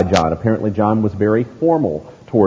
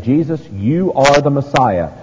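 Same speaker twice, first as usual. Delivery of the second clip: building, ringing proclamation; emphatic preached declaration; emphatic confession; 3 semitones higher, 3 dB louder than usual. Acted. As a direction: declare it with full preacher's conviction, pitch lifting. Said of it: "never more emphatic than this time".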